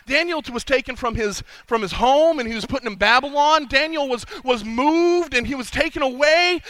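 usual speaking voice

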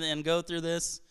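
usual speaking voice

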